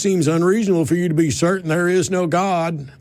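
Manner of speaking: mocking the caller in an exaggerated Southern accent. Host mocking the caller's words in a mock Southern accent. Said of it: "take the Southern accent and make it sound stupid".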